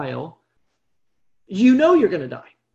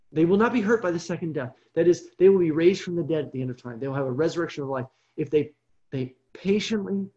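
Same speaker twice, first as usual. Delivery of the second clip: normal talking voice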